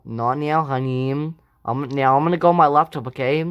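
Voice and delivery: put-on low, offhand dad brushing his kid off. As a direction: drop way down and wave her off like a busy father. Dad character brushing daughter off.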